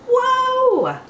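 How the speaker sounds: high, wide-eyed whoa; big storybook whoa; wondering whoa. Acted. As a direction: gasp of wonder for the kids. Wonder at the solar system.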